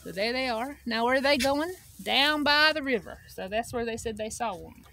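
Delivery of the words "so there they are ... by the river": ordinary delivery, nothing marked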